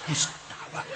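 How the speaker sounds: whispered angry summons; mother hissing for her son to come out; hissed call. Acted. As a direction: hiss it through the door. Angry Mary at the temple.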